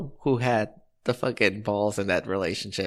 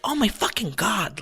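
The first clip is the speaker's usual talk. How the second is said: mock irritated scream; 9 semitones higher than usual. Daughter fed up with her mom, high mock scream. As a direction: imitate a fed-up daughter, pitched up. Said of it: "she's screaming".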